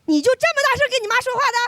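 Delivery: fast, high mock mom scolding; mother snapping at her kid for talking back; mom's scolding. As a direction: rattle it off like a nagging mother. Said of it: "这句话就是".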